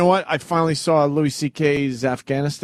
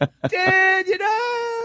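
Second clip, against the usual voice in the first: excited outburst; much slower than usual. Real, not acted.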